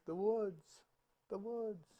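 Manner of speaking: soft, fading echo from afar. Acted. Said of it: "a voice comes back".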